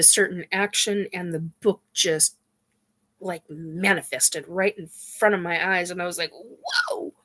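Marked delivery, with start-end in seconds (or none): 6.3-7.1 very high, astonished exclamation; amazed whoa. Retold or imitated. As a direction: gasp it out in wonder. Book manifests before her eyes.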